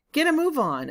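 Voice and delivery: high, loud impatient snap; impatient order. Acted. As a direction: hurry him up sharply. Farmer snapping at the boy.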